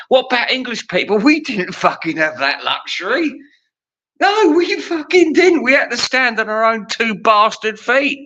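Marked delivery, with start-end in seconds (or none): 4.2-8.2 high-pitched comic outrage; indignant rant. Real, not acted. Comic ranting on behalf of English people, high indignant protest.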